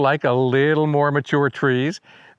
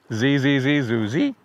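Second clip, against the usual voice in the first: even zees with the last note flicked up; chanted bird call; birdsong mnemonic. Shown as a demonstration. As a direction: imitate the warbler in buzzy syllables. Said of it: "you're gonna hear this bird".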